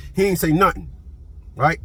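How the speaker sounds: ordinary delivery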